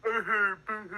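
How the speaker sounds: high-pitched fake crying; badly faked, high boo-hoo sob; mock boo-hoo. Scolded guest to host, mock crying. Mocked for whiny voice, fakes crying.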